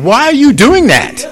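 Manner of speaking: loud, high-pitched incredulous question; judgmental challenge. Acted. Preacher mimicking what Saint Francis didn't say, loud incredulous challenge. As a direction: act out a judge calling someone out. Acted judging question he rejects.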